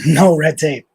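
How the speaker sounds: ordinary delivery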